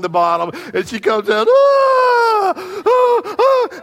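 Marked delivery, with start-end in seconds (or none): 1.5-3.8 put-on high, loud gasping screams of a little girl; mock high screams. Little girl coming up from the wave, screaming high. Daughter knocked under by wave.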